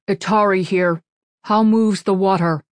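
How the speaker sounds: loud, slow alien ritual greeting; alien formal voice. A narrator's reading. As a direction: speak like a stately alien. Alien jelly speaks formally.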